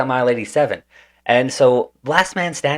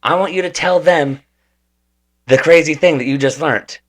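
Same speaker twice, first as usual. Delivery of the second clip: eager instructions; 6 semitones higher, 6 dB louder than usual. Retold or imitated. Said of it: "He's like".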